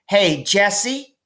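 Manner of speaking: put-on high, eager little girl calling; little sister voice. Little sister Maybell calling to her brother, high and eager. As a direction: pipe up like a small sister.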